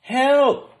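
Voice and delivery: high loud cry for help; cried out. A narrator's reading. Cab driver cries out at the loose bear. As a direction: shout for help in alarm. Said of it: "cried a cab driver".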